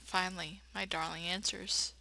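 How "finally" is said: ordinary talk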